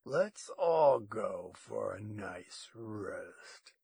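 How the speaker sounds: slow, low, drawn-out purr; menacing low ranger voice, very slow; purring menace. A narrator's reading. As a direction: purr it slowly as a veiled threat. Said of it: "The ranger's voice was a purr".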